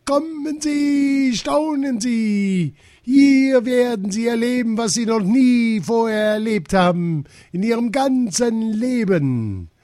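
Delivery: high, slow, stretched-out showman's call; ringmaster's announcement. A narrator's reading. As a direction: tout the show with huge drawn-out pomp. Ringmaster rehearsing his grand announcement.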